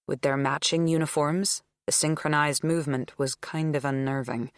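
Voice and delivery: plain reading voice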